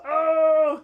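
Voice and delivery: high, loud drawn-out burst; hyped voice crackle. Retold or imitated. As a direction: imitate your own over-hyped outburst. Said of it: "my voice will crackle and go".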